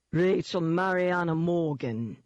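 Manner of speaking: slow, mocking London thug voice. A narrator's reading. London demon taunts cornered heroine by name, mocking. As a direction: drawl the full name in mockery. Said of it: "it mocked".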